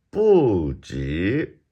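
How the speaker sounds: "不" falling from high, "及" rising; fourth then second tone. Shown as a demonstration. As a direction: drop "不" long, then swoop "及" upward. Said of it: "fourth, second".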